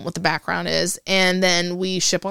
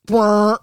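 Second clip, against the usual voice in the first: deflated raspberry; 2 semitones higher, 7 dB louder than usual. Retold or imitated. Deflation at bad assignment.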